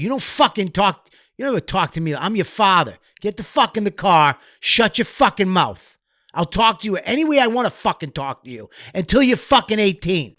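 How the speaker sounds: a hint of a stern, bullying father, pitched high; harsh father. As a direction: play the old-school father laying down the law. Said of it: "a father would have been like".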